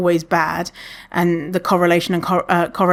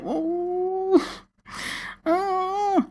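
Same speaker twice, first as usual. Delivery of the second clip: disapproving ooh; 12 semitones higher, 6 dB quieter than usual. Retold or imitated. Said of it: "I'm always just like".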